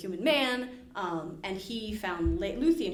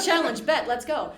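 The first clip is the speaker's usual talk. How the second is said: eager challenge accepted; 4 semitones higher, 6 dB louder than usual. Retold or imitated. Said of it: "Beren's like".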